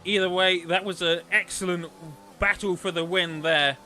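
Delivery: loud, energetic play-by-play; live race commentary. Real, not acted. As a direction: call the finish like a race commentator.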